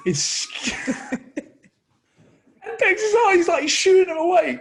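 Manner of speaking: high, loud, breaking into laughter; can barely get the words out for laughing; laughing while telling. Real, not acted.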